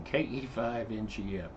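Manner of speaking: ordinary delivery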